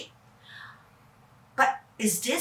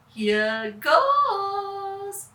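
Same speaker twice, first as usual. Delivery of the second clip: excited; 7 semitones higher than usual. Acted. Kevin starts the helicopter.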